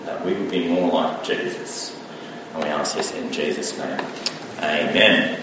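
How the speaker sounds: low, soft prayer voice. Real, not acted. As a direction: pray quietly, with unhurried pauses. Leads a prayer.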